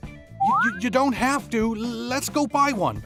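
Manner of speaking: stammer, then push the offer eagerly; flustered insistence. Acted. Boy offering to replace the dress, flustered.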